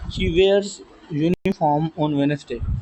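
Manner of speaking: each word of uniform on Wednesday drawn out; slow dictation. Shown as a demonstration. Teacher reading the example to students, slowly. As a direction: spell it out slowly for the class.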